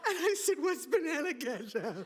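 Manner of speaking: laughing. Real, not acted. Laughing at banana ketchup.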